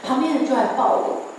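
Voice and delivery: usual speaking voice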